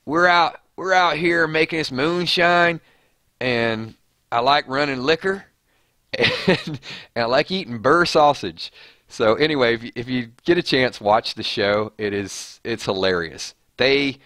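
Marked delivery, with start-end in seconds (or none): 0.0-8.7 mock Southern moonshiner. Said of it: "It's like".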